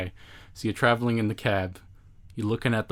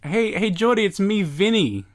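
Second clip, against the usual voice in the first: Vinny; 10 semitones higher, 5 dB louder than usual. Acted. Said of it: "Who's Vinny?".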